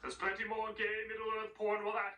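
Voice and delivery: slow put-on villain voice; lightly hammed-up movie villain; character voice. Character voice in parody.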